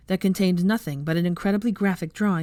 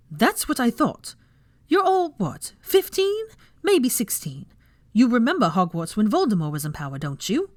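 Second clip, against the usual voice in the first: scornful lecture; 6 semitones higher, 3 dB louder than usual. A narrator's reading. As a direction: belittle them from above. Hermione lectures cowed students.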